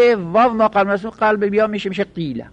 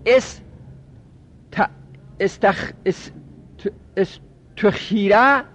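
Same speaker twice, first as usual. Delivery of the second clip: halting, broken syllables with pauses; sounding it out; 3 semitones higher, slower than usual. Real, not acted. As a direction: feel out the word piece by piece, drawing out the end. Working out a passive form aloud.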